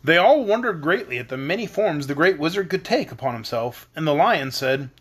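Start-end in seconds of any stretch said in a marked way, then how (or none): none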